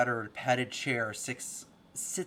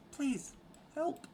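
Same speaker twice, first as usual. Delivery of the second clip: pleading cry for help; 3 dB quieter than usual. Acted. Lindsay cries out to you as the door opens, high-pitched pleading. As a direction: cry out for help in a much higher voice. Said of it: "she cries out to you".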